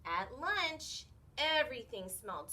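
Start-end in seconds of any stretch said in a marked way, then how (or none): none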